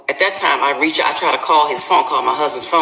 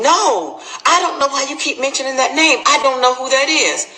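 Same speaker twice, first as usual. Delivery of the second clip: high, rushed protest of innocence; insistent denial; 10 semitones higher than usual. Retold or imitated. Husband questioned about the other woman, indignantly denying. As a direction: imitate him flatly denying everything.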